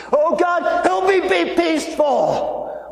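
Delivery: high, slow whiny prayer voice; mock prayer. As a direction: pray like a fretful hypocrite.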